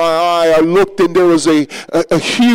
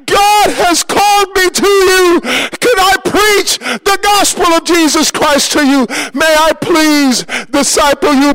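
desperate shouted calling; screaming for attention; 10 semitones higher, slower than usual. Retold or imitated. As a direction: yell to the distant men, begging. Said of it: "but I began to scream".

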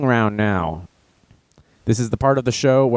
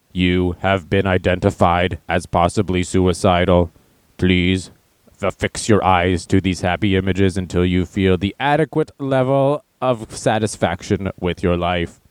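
low, even machine monotone; robot voice; 6 semitones lower than usual. Pretend. Facebook robot addressing a flagged user, robot voice. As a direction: intone it like a slow, low robot.

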